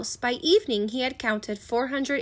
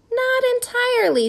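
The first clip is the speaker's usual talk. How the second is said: objecting; 12 semitones higher than usual. A narrator's reading. Missus Pepper disputing the tally, high-voiced objection. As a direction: voice lifted high in polite dissent.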